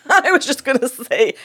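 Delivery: laughing. Real, not acted. Guest laughing at the host's teasing about multitasking. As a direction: giggle while owning up to the fumble.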